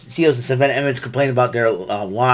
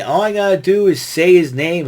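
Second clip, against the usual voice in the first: "All I gotta do" said higher and louder; louder announcing; 6 semitones higher, 5 dB louder than usual. Retold or imitated. Retelling his own prediction, louder. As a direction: call it out like a hype man. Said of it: "and then I say".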